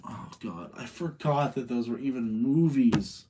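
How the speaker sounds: ordinary talk, nothing marked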